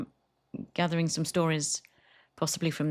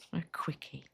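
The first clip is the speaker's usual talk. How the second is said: whispered punchline; 10 dB quieter than usual. Real, not acted. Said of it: "repeating the punchline softly".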